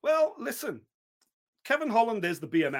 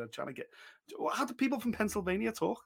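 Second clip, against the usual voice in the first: usual speaking voice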